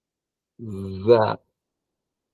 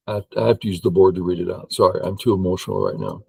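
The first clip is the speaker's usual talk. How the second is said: normal talking voice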